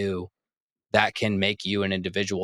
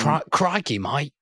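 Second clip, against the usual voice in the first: higher, hammed-up Australian greeting; bad Australian accent; 5 semitones higher than usual. Host imitates an Australian listener, in a bad Australian accent. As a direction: put on a shaky attempt at Australian. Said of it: "I immediately went Australian".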